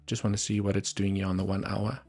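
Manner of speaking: ordinary talk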